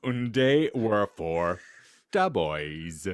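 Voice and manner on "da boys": normal talking voice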